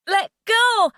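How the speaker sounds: high, loud panicked scream; screaming at the man holding her ankle. Acted. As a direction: scream at him to let go. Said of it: "I screamed at him".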